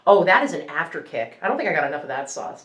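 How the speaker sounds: usual speaking voice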